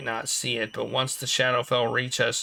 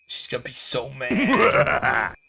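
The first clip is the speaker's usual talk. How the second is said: slow, loud fretful cry; mock panic; 3 semitones higher, 5 dB louder than usual. Player fearing an angry master, mock-panicked loud wail.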